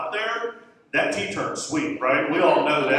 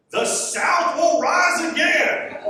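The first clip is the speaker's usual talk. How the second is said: high, slow mock Southern proclamation; boyfriend's Southern accent; 3 dB louder, much slower than usual. Quoting the boyfriend's most Southern line, exaggerated Southern accent. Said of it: "I'm saying how he said it".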